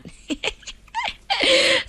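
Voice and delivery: high, bright burst of laughter; high delighted laugh. Real, not acted. DJ laughs at a fan's compliment, high laugh.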